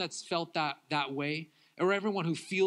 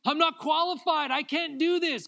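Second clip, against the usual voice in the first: desperate cry; 11 semitones higher, 4 dB louder than usual. Retold or imitated. Believer at the end of their strength crying to God, desperate. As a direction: hit the wall and cry out. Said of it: "where you're like".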